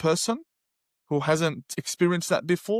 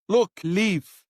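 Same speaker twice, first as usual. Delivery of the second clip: mild mock anger, a bit louder; angry retelling; 4 semitones higher, 4 dB louder than usual. Man telling the helper to leave in mild mock anger. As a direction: order him to leave, half-heated. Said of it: "I got really angry".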